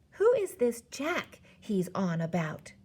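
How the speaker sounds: ordinary delivery, nothing marked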